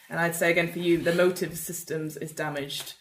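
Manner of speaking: ordinary delivery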